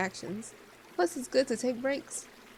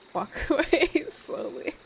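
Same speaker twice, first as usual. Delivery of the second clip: laughing; 6 semitones higher, 4 dB louder, slower than usual. Acted. Joking stage direction.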